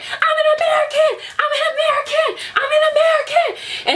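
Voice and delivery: high repeated mock shriek; acted-out squealing plea; retold screaming. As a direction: scream it over and over in comic panic. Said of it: "Like, I'm screaming".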